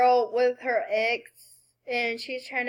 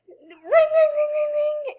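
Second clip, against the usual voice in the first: imitating a phone ring; 13 semitones higher than usual. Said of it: "So I'll be like".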